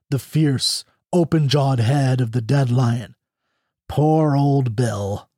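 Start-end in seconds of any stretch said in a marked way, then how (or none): 3.9-5.3 slow, drawn-out lament; understated grief, long slow words; mournful. A narrator's reading. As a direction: mourn a lost pet, stretching each word. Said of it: "he exclaimed mournfully".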